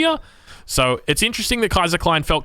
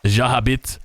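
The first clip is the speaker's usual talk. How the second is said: English-accented French, Saguenay dropped much lower; one more go at French, landing the place name low; English speaker's French; 5 semitones lower than usual. Shown as a demonstration. Caster's third French attempt for his co-caster, English-accented French. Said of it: "we'll go one more round".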